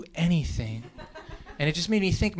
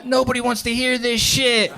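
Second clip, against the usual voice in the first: yelling; 8 semitones higher, 4 dB louder than usual. Retold or imitated. Guy on the train yelling at the band, angry yell. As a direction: yell it over the band, stretching the words. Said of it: "yelling at them".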